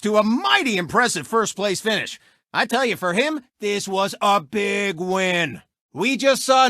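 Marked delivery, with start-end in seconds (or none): none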